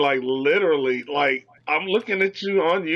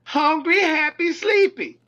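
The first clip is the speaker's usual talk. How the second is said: teasing singsong; 11 semitones higher than usual. Real, not acted. Teasing list about weed.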